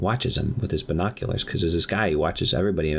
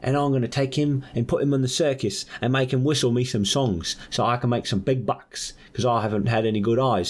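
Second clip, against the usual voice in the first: higher, hammed-up Aussie voice; Australian accent; 4 semitones higher than usual. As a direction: play a scheming Australian, broad accent. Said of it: "he's clearly Australian".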